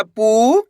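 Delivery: पू held long, gliding up; stretched vowel. Shown as a demonstration. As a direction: stretch the ऊ in पू upward. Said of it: "तो खींच के पढ़ना है".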